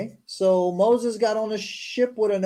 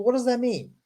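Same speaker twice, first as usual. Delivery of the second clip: ordinary talk, nothing marked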